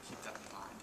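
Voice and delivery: hushed, breathy reminder slipped in; whispered aside. Real, not acted. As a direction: lean in and whisper it to the audience.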